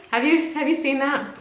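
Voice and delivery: usual speaking voice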